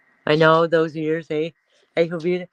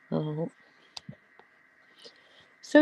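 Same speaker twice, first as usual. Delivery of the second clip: normal talking voice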